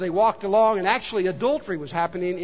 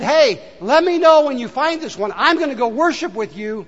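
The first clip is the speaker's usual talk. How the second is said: raised, louder mock eagerness; eager request; 4 semitones higher, 6 dB louder than usual. Preacher quoting Herod's promise to the Magi, eager. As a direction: call it out keenly as Herod.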